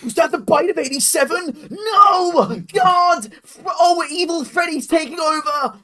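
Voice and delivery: high, loud streamer hysterics; hammed-up horror, wailing at a game; mock gamer panic. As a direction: overact terror like a screaming YouTuber. Mock gamer panic in Markiplier bit.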